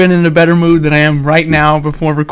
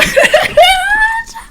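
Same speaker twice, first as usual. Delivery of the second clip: squealing laugh. Real, not acted. Laughing at the bit.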